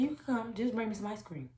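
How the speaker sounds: normal talking voice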